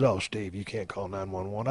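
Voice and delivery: low, soft mock objection; imitating disapproving wife. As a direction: quietly voice a wife's weary protest.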